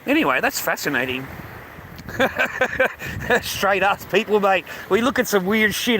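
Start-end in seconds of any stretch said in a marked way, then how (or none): 1.3-3.4 airy high laugh; breathy laugh. Real, not acted. Laughing at staring at beach waves, breathy laugh. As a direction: laugh at yourself.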